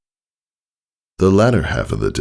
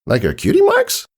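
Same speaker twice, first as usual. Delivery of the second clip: much higher puzzled filly voice; confused Sweetie Belle. Acted. Sweetie Belle puzzled by Scootaloo's answer, high confused echo. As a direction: echo it back, baffled. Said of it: "Sweetie Belle echoed in confusion".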